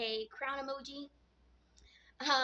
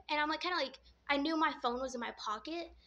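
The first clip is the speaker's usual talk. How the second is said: ordinary talk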